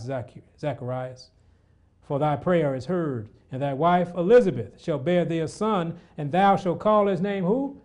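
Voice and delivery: loud, slow, rising scripture read; preacher reading scripture aloud; fervent scripture reading. Acted. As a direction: proclaim the passage to the hall.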